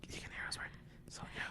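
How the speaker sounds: unvoiced whisper into the mic; giggly prank whisper. Real, not acted. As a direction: whisper close and playful. Whispering to listeners.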